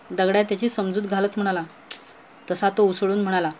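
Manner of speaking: ordinary delivery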